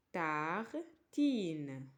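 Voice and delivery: Tartine stretched slowly over each syllable, tar-tine, pitch low; slow model word. Shown as a demonstration. Teacher repeating Tartine slowly for children to copy.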